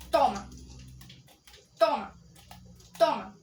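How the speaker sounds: loud triumphant toma; shouting while attacking. Real, not acted. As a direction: bark it with each hit. Hitting enemy repeatedly.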